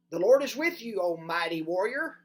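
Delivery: loud, proclaiming angel's greeting; booming angel. Acted. The angel hailing Gideon in a loud proclaiming voice. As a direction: call it out grandly.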